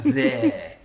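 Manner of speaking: THERE with a long, widened "air" vowel; drawn-out "air" in THERE; bad Jermaine Jenas impression. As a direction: stretch "there" wide, an amateur imitation. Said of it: "that's a bad impression".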